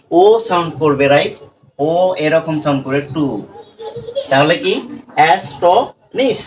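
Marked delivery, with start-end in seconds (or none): 5.2-6.4 অ্যাস্টনিশ said very slowly, syllable by syllable; slow demo word. Shown as a demonstration. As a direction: stretch out অ্যাস্টনিশ carefully for learners. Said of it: "এই নিশটা তুমি odd pattern ধরে নিবে".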